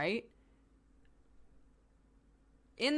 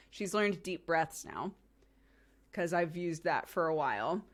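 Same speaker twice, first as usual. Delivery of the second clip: ordinary talk, nothing marked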